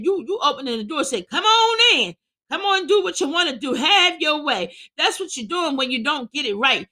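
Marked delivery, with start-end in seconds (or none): 1.2-4.7 high, exaggerated inviting call; mock welcome. Sinner opening the door to the devil, mock welcome. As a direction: call it out as an over-eager invitation.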